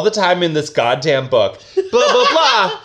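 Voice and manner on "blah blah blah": high, laughing blah blah blah. Real, not acted. Host mocking the book while laughing. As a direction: toss it off with a laugh.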